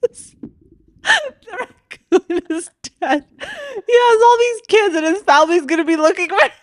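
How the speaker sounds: high, slow, teary wail for a dead raccoon; hammed-up sobbing, voice cracking high; mock bawling. Friend retelling her roadkill meltdown, mock bawling high and slow. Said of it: "And I am bawling".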